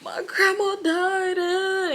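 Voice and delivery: high, drawn-out imitated wail; mock sobbing, high and stretched; boohoo crying. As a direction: wail it slowly like someone bawling to an officer. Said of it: "he was really boohoo crying".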